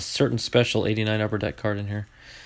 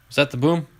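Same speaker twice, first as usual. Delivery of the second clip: usual speaking voice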